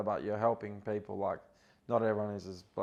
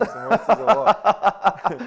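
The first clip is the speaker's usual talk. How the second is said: slow, high, loud laughing question; amused disbelief, laughing hard; 11 semitones higher, 14 dB louder, much slower than usual. Real, not acted. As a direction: ask it while cracking up.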